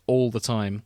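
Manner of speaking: ordinary talk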